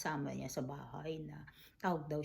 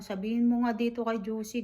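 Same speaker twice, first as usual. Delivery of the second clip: raised, louder mock complaint from an upset old mother; imitating angry mother; 6 semitones higher, 8 dB louder than usual. Mother on the phone angrily ordering her child, louder. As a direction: play the furious mother demanding her child act. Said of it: "galit na galit siya kay Josie".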